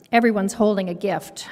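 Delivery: ordinary delivery, nothing marked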